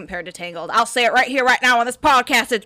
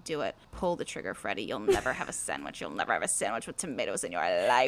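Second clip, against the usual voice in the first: much lower, soft sinister goading; low menacing taunt; 13 dB quieter, faster than usual. Retold or imitated. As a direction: whisper him toward the edge. [name] voiced goading Freddy.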